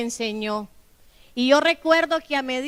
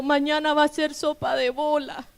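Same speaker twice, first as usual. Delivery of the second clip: retold crying; 3 semitones higher than usual. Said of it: "Y ellos llorando".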